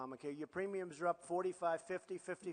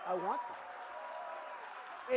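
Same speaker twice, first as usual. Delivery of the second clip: لفظ بطيء جدا وممدود; drawn-out I want that; much slower than usual. Shown as a demonstration. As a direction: stretch I want that very slow and airy. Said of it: "repeated slowly".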